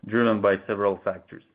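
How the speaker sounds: ordinary delivery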